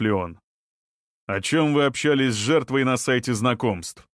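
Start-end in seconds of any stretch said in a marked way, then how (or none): none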